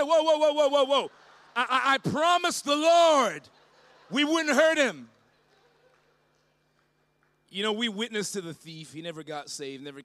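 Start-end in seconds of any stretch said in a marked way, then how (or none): 0.0-5.1 loud urgent whoa, hands-off warning; urgent holding back. Retold or imitated. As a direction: stop a friend before he swings, loudly. Holding back Steve from fighting.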